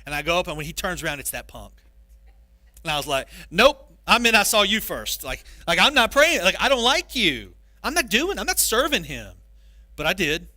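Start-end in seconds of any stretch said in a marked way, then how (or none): 3.5-9.3 loud, high, stubborn protest; indignant refusal. Retold or imitated. Pastor acting out refusing to pray for the punk, loud and indignant. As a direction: huff like a sulking kid refusing. Pastor voicing his angry younger self.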